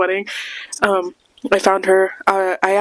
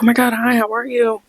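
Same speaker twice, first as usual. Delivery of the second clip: higher-pitched fan greeting; retold gushy hello; excited greeting; 5 semitones higher than usual. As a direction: greet someone you admire, pitched up and polite. Acting out meeting a star.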